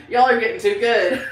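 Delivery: amused, high and loud through laughter; laughing. Retold or imitated. Laughs while retelling guessers' success.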